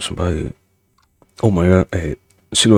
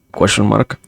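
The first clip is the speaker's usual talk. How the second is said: usual speaking voice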